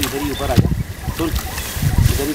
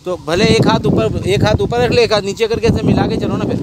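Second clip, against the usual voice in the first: loud, higher calling out; calling instructions; 6 semitones higher, 7 dB louder than usual. Real, not acted. Man shouting directions to helpers carrying the net. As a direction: direct them loudly from afar.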